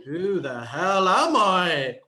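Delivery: slow, drawn-out, loud bewilderment; acted identity crisis, loud and stretched; exasperated cry. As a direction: wail it in mock despair. Acting out identity overload.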